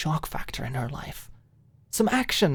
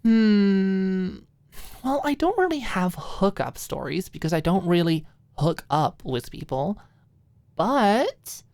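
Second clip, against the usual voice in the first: hesitant, higher sheepish hedging; 6 semitones higher, 4 dB louder than usual. Acted. Maud sheepishly dodging Alicia's question. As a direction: hedge shyly before confessing. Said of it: "a sheepish smile".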